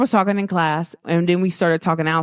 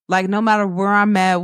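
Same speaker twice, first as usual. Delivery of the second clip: ordinary talk, nothing marked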